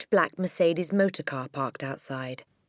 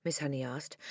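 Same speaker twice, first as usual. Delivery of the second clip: plain reading voice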